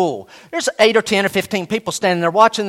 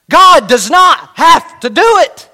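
much higher and louder, slow and ringing; emphatic repeat; 9 semitones higher, 8 dB louder, slower than usual. Shown as a demonstration. Preacher ringing out the key truth to the congregation, emphatic. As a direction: proclaim it like the climax. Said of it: "I'm gonna say it again".

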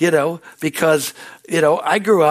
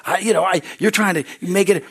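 usual speaking voice

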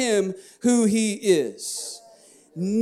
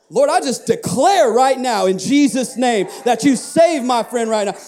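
louder, higher, declared with full confidence; bold declaring prayer; 4 semitones higher, 4 dB louder than usual. Shown as a demonstration. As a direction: declare it boldly, no doubt left. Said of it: "you see the difference?".

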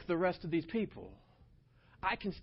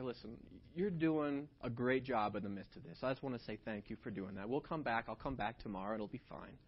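soft thanks; 5 semitones lower, 4 dB quieter than usual. Retold or imitated. Thanking the clerk gently.

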